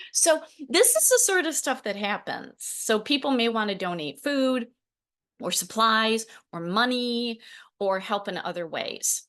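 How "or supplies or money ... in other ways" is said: ordinary talk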